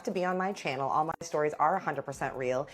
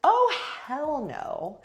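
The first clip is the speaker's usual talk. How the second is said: slow, drawn-out, higher outrage; indignant hell no; 9 semitones higher, 4 dB louder, much slower than usual. Retold or imitated. After the dancer pushes her, slow indignant retort. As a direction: stretch it out, offended. Shoved from behind.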